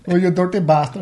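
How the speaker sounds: mock a foreign accent on Irish slogans. Friend imitating a comedian's foreign accent on Irish slogans. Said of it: "His mate that can do the accents".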